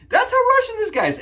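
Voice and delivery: mock hype. Hyping the Russian razor.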